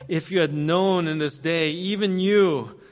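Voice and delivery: high, slow, aching plea; Jesus lamenting. Acted. Jesus weeping over Jerusalem, pleading with the city, high and slow. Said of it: "Look what he says in verse forty-two".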